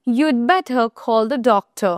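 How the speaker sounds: very slow, spaced-out example. Shown as a demonstration. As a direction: drag out every word for learners. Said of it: "example sentence very slowly". (খুব ধীরে উদাহরণ)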